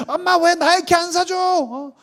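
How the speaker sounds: very high-pitched whining complaint; hammed-up teenage tantrum at mom; quarrelling daughter. Daughter fights mother over what she bought, indignant whine. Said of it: "막 박터지게 싸웠어".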